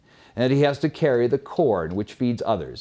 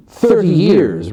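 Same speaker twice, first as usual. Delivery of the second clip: loud, slow and weighty; booming divine verdict, slowed; God's voice; 4 semitones higher, 10 dB louder than usual. Acted. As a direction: pronounce it like the Lord deciding fates. God answers the ass.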